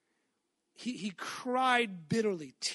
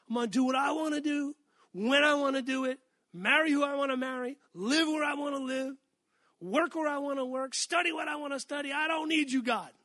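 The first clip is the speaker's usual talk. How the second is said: higher-pitched, rattled-off list of self-willed demands; mock defiance; 4 semitones higher than usual. Preacher voicing people who won't follow God anymore, mock defiant. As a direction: push back at God in a high, insistent run.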